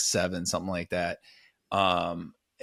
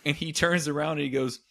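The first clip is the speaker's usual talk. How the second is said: ordinary talk, nothing marked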